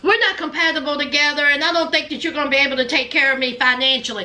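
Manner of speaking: low, rushed monotone recital; mimicking Amanda. Retelling Amanda's breakup excuse to Razvan, mimicked low and fast. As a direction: imitate her complaint in a low, fast drone.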